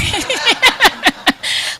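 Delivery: a short real laugh; quick laugh at himself; amused laugh. Real, not acted. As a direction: laugh it off lightly. Laughs at his own retort.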